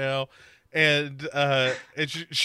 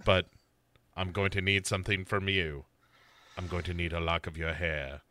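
very low, soft, creepy voice; imitating serial killer; 9 semitones lower, 8 dB quieter than usual. Killer naming his price to Sarah, low creepy voice. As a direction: make the request quietly, deep down low. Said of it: "And he's like".

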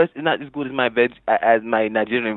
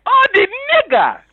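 very high-pitched mock cry of alarm; high, hammed-up quoted exclamation; imitated outcry; 4 dB louder than usual. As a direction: mimic someone crying out, way up high. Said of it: "and they're like".

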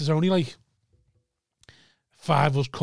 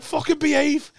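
mock drunk retort; 10 semitones higher, slower than usual. Retold back-and-forth.

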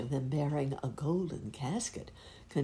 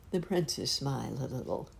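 usual speaking voice

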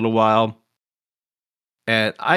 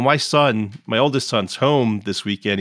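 ordinary talk, nothing marked

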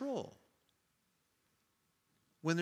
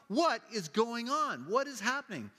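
impatient asking; 9 semitones higher, 4 dB louder than usual. Retold or imitated. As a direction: demand to know what's up. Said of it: "she's asking people in front".

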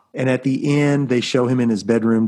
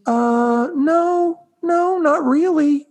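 sheepish high voice; much slower than usual. Retold or imitated. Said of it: "He goes".

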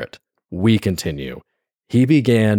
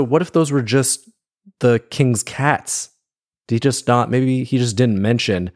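ordinary delivery

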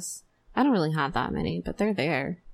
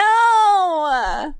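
long, high, loud wail of refusal; shouted protest; 11 semitones higher, 5 dB louder than usual. Real, not acted. Host appalled by the gravy joke, shouted protest. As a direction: cry out in appalled protest, stretching the word.

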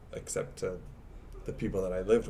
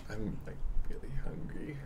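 whisper; 6 semitones higher, 5 dB quieter, faster than usual. Real, not acted. Whispered aside.

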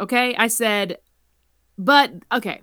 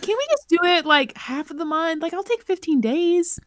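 pleading whine; 9 semitones higher than usual. Retold or imitated. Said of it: "I'm like".

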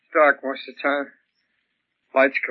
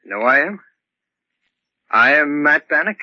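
ordinary talk

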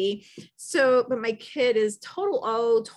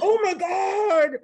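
shocked; 6 semitones higher, 4 dB louder, slower than usual. Retold or imitated. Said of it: "covering my mouth going".

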